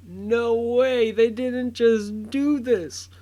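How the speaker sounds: high, loud, slowed cry of disbelief. Retold or imitated. Fan reliving the theater cameo moment, high loud disbelief. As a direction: relive the shock of the cameo, stretched out. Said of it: "because I was like".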